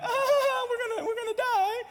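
put-on high-pitched giggly girls; mock laughing panic. Girls laughing in the riptide as help arrives, mock laughing. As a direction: imitate girls squealing that they're doomed. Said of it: "they were laughing".

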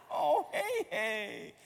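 slow, high, mock-mournful child voice; heartbroken little girl. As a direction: draw out a child's lament, hammed-up. Repeats daughter's grieving over nugget.

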